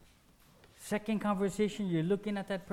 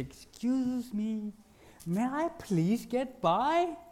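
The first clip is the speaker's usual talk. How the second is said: polite request; 5 semitones higher, slower than usual. Acted. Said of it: "my most polite voice".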